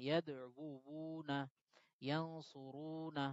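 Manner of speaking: ইয়াদউনা and ইয়ানসুরুনা both drawn out slowly; ইয়াদউনা, ইয়ানসুরুনা stretched syllable by syllable; slow matching demo. Shown as a demonstration. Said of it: "মিলে গেছে".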